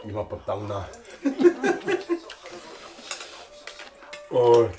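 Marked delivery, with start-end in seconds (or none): 0.9-4.3 airy unvoiced laugh; breathy laugh. Real, not acted. Laughing at spicy food.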